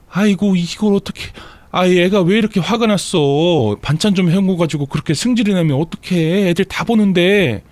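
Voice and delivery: put-on high, lamenting older relative's voice; wailing reproach in a put-on high voice; distressed mother-in-law. A narrator's reading. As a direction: fret and plead over the mess. Distressed mother-in-law sees thrown dishes.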